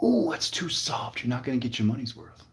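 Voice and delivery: quiet mock-flirty coaching; smooth-talking produce-aisle guy. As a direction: play a softly disapproving suitor. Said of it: "he's gonna be like".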